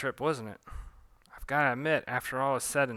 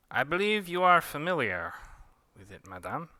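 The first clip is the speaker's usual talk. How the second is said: put-on higher, louder French-accented Pontu; 6 semitones higher, 3 dB louder than usual. Pontu the magician offering the grapefruit in a French accent. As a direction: purr it like a suave French magician. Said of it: "losing my Pontu accent".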